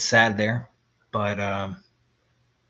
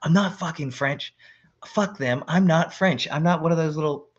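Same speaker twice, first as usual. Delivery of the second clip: indignant denial; 5 semitones higher, 4 dB louder than usual. Retold or imitated. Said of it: "he's like".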